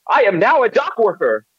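high-pitched, hammed-up dock worker; put-on dock worker voice. Player posing as a dock worker to the group, silly voice. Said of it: "What is that fucking voice?".

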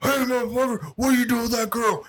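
high, hammed-up angry punk; rowdy punk voice. Scrawny drunk guy confronting the cops, high mock yell.

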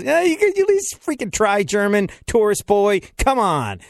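high-pitched mock exasperation; hammed-up fed-up local; implied scolding. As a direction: scold the tourist in mock irritation. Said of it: "But the implication is".

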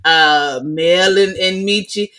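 ordinary talk, nothing marked

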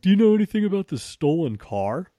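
loud, high probing question; cop's question. Retold or imitated. Officer questioning the homeowner, loud and high. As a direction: press him like a suspicious officer. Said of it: "And they're like".

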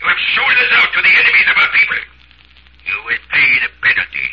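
high, fast furious shouting; angry accusation. Acted. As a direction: denounce him as a traitor, shouting. Party accuses a traitor.

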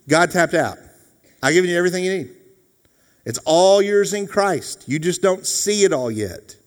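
high-pitched, insistent pulpit voice; impassioned preaching. Real, not acted. Preacher telling believers it is all theirs, impassioned. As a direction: press it on the congregation with force.

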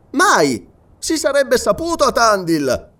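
high, loud, categorical no; emphatic denial. Acted. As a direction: exclaim it with total certainty. Sergeant emphatically denies hearing of prisoners.

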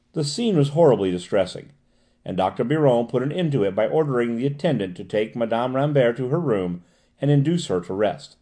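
usual speaking voice

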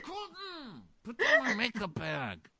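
very high mock protest; exasperated scolding. Contestant catches him taking her makeup bag, mock exasperated. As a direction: squeal it in comic outrage.

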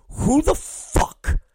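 slow, high, voiceless whisper of disbelief; a hushed, drawn-out what-the-hell; incredulous whisper. Retold or imitated. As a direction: breathe it out, stunned. Retold disbelief at a name.

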